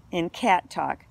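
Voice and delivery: ordinary delivery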